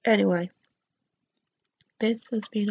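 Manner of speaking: lips stiff under wet mask; barely moving the face; through a sheet mask. Real, not acted. Seen 0: Sheet mask covers face during words; lips exposed through cutout.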